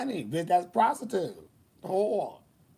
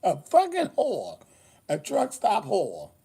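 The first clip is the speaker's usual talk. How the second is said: mock insult; 5 semitones higher than usual.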